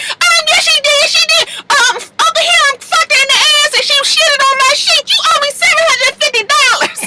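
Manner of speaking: very high, far-off Pooh impression. Pooh answering Carly at the table, very high voice. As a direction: squeak it up high and cheeky. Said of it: "she was just like".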